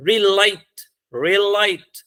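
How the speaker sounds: Relight with weight on the light part; stress on second syllable. Shown as a demonstration. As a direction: model Relight slowly for learners, stressing its last syllable. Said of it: "ल पे". (Relight, light वाले हिस्से पर ज़ोर)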